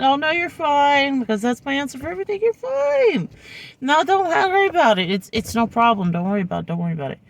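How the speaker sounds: high, sweet people-pleaser voice; overly polite reassurance. Retold or imitated. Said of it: "I just went".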